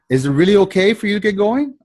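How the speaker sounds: timid asking. Shown as a demonstration. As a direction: plead for permission, unsure of the answer. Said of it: "I'm not asking her".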